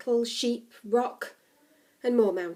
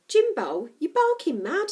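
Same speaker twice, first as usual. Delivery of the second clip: higher, louder mild protest; incredulous objection; 6 semitones higher, 6 dB louder than usual. A narrator's reading. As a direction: protest as if the idea is crazy. Becky objects to a night walk.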